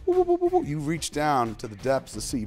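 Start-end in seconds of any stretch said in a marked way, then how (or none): 0.1-0.6 very high woo-woo call; high hooting summoning noise. Retold or imitated. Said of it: "you're like".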